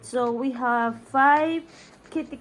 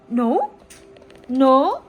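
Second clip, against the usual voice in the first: louder, playful questioning; teasing call; 9 dB louder than usual. Real, not acted. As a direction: tease the dog with a loud no.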